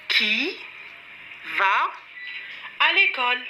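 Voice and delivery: "Qui ... va ... à l'école?" with clear gaps; teacher spacing out "Qui", "va", "à l'école"; word by word. Shown as a demonstration. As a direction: pause after "Qui" and after "va". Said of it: "la personne qui va à l'école".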